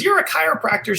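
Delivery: put-on very high-pitched elderly New York accent. Elderly customer spots chiropractor's shirt, New York accent. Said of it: "in her New York accent".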